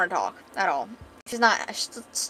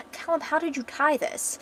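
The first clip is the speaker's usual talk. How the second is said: higher acted irritation ending in loud ugh; aggravated; 6 semitones higher, 3 dB louder than usual. Woman struggling with her bandages, aggravated. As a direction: grumble up to an exasperated groan. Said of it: "she's starting to get really aggravated".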